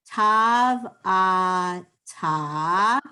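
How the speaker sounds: teacher leading the class, tav, ah, ta; sounding out letters. Shown as a demonstration. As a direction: read each sound aloud for the class to repeat. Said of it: "Line one".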